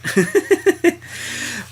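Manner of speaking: high bursting laugh; a sudden high laugh. Real, not acted. Laugh at joke.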